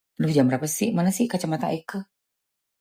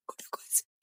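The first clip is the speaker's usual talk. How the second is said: whisper; 19 dB quieter, faster than usual. Real, not acted. Repeat whispered to self.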